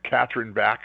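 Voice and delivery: Bach said as BACK, short flat a. Shown as a demonstration. Showing two ways to say the name, BACK. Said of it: "You can say Bach or Bach".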